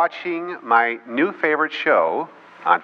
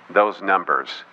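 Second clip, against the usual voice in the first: ordinary delivery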